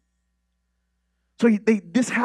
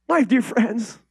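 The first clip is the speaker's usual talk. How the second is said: usual speaking voice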